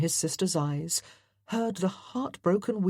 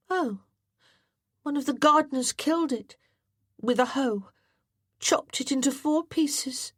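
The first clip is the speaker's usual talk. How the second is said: put-on higher young boy's voice, brisk; boy John; 9 semitones higher than usual. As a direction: tell it quickly, a little sheepish. John tells snake's fate.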